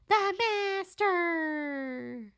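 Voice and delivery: high, very slow and drawn out; hammed-up ominous announcement; dramatic villain voice. Shown as a demonstration. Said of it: "Ah, yes, the master!".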